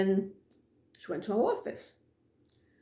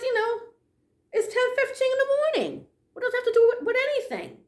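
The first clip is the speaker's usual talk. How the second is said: much higher, incredulous voice; indignant disbelief; 14 semitones higher than usual. Retold or imitated. To the director on the phone, indignant and much higher. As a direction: make it sound like an absurd question. Baffled by director's odd question.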